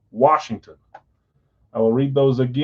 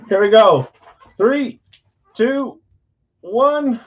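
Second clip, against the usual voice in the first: excited countdown; 11 semitones higher, 6 dB louder, slower than usual. Real, not acted. Countdown before opening box.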